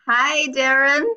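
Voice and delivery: ordinary talk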